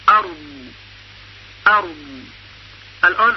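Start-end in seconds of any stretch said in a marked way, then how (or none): 0.1-1.8 ارض with the final ض held long and heavy; tajwid demo of ارض. Shown as a demonstration. Teacher demonstrates the word. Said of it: "مثل کلمهٔ ارض".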